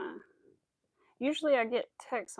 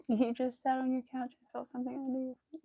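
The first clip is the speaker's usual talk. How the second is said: ordinary delivery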